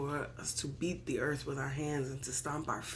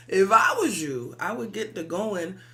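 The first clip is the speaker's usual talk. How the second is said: laughing, then a loud shout; shouted warning with a laugh; 2 semitones higher, 8 dB louder than usual. Acted. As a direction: bark the advice loud with a laugh. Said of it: "So I suggest".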